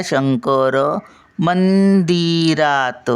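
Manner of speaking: "शंकर मंदिरात," very slow, holding "शं" and "मं"; अनुस्वार उचलून धरून. Shown as a demonstration. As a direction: stretch each nasal of "Shankar मंदिरात," then release syllable by syllable. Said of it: "शं उचलून धरलं". ("शंकर मंदिरात," शं आणि मं लांबवून)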